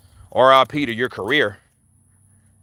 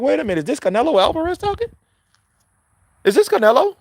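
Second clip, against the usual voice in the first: mock surprise; 11 semitones higher, faster than usual. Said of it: "I'm like".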